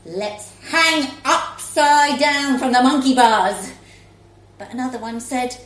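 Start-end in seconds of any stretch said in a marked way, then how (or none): 0.0-3.7 loud call; shouted suggestion; enthusiastic shout. Acted. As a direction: call the idea out to everyone. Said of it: "A different mum shouted".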